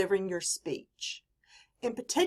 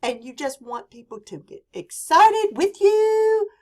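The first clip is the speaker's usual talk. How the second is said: high, loud, stretched-out excitement; really high pitch; 12 semitones higher, 8 dB louder than usual. Shown as a demonstration. Teacher demonstrating pitch to get people excited, very high. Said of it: "Your pitch can go really, really high".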